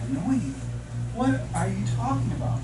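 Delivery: high, loud mock disbelief; incredulous. Husband answering his upset wife, incredulous. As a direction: imitate a baffled husband protesting. Husband reacting to wife's tearful apology.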